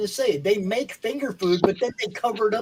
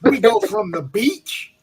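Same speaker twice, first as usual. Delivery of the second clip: sleazy ad voice; 3 semitones lower, 5 dB louder than usual. Acted. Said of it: "I feel violated".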